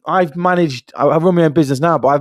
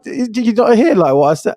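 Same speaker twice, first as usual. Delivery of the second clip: incredulous question; 7 semitones higher than usual. Retold or imitated. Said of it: "I was like".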